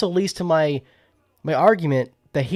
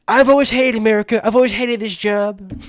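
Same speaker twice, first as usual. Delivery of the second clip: Trump impression; 7 semitones higher, 5 dB louder than usual. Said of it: "Donald Trump will ... be like".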